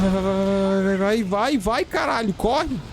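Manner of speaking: high panicked cries; panicked urging. Real, not acted. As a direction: scream at yourself to hurry. Chased, urging character on.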